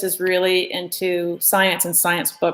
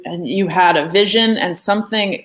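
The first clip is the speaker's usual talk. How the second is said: normal talking voice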